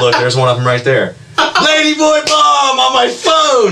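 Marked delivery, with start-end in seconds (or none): 1.7-3.7 excited shout. Real, not acted. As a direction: shriek it out in delight. Showing the text photo, shouting.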